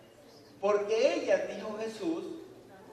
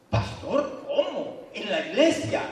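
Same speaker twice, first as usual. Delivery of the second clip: protesting church member; 2 semitones higher, 5 dB louder than usual. Acted. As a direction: play a shocked listener. Congregant objects to pastor.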